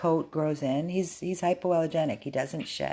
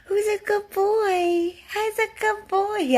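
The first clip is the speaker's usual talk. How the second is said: high-pitched dog talk; 3 dB louder than usual. Real, not acted. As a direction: coo in a high squeal to a beloved dog. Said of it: "He loves when I talk all high pitch".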